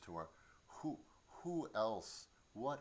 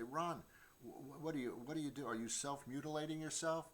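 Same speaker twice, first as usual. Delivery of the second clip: higher, alarmed questioning; concerned onlookers; 6 semitones higher, faster than usual. Retold or imitated. Friends see his scratches and ask with mock alarm, higher voice. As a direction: worry over his scratched arms. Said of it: "and people would say".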